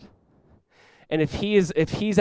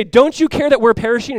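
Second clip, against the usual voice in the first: raised, loud accusing cry; 8 semitones higher, 11 dB louder than usual. Acted. Disciples in the storm accusing Jesus, loud and high. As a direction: cry out in reproach. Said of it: "they're accusing Jesus".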